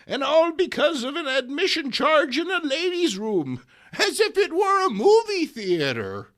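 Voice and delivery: put-on high, loud, fussy old woman; high fussy old lady exclaiming. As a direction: squawk it out like a scandalised granny. Reads old lady's dialogue.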